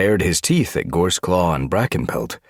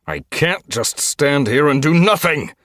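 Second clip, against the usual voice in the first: high, loud exasperation; frustrated outburst; 11 semitones higher, 6 dB louder than usual. A narrator's reading. Trapped leader desperate to act, frustrated outburst. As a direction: burst out, unable to bear waiting.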